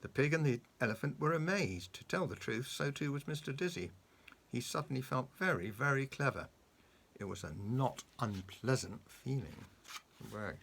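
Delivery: plain reading voice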